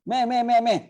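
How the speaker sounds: high loud calling; calling out come come. Retold or imitated. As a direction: wave someone over with a loud high call. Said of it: "jawab keras juga".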